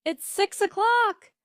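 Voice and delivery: high, loud motherly shout. A narrator's reading. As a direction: shout the time up to a sleepy son. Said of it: "his mother shouted".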